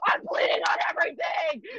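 high desperate shout; bleeding at the bathroom door, high panicked shout; desperate yell. Retold or imitated. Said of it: "I'm like".

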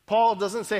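ordinary delivery

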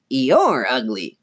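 slow, lightly squeaky puppet retort; Slappy. A narrator's reading. Dummy insults Chris back, lightly voiced squeak. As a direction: draw it out as a dummy, slightly squeaky. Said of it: "in Lindsay's squeaky voice".

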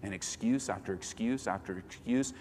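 ordinary delivery

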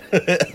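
burst of laughter. Real, not acted. Laughs mid-sentence.

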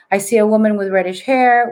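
normal talking voice

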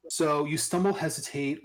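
usual speaking voice

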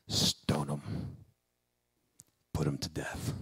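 very low, hushed, grim sentence; voice of the law. Acted. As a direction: pronounce doom under your breath.

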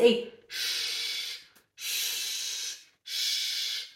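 long drawn-out shushing sound; unvoiced sustained shh; hushing shh. Shown as a demonstration. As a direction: hush someone with a long "shh". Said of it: "fala assim".

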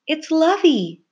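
high, loud cry of recognition; delighted high exclamation; excited cry. Acted. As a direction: cry it out high, thrilled to see who it is. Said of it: "cried Flora".

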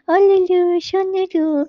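put-on high, loud cooing baby talk; mother cooing sweet nonsense to the baby. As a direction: coo at the baby like a doting mother. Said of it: "करत त्याचे लाडच करते".

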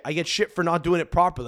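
ordinary delivery

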